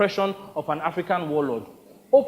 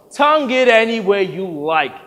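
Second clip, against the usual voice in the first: loud, raised-pitch dismissal; impatient interruption; 4 semitones higher, 7 dB louder than usual. Acted. Star cutting off an actor's question about accents, impatient interruption. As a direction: cut in loudly and brush the question off. Howard cuts off Victor impatiently.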